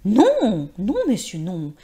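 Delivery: un non éclatant, fort et aigu; elle proteste de toute sa voix; crie avec force. Acted. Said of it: "Elle s'écria avec force". (la vieille actrice s'écrie avec force)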